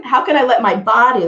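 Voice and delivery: ordinary talk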